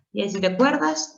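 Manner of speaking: normal talking voice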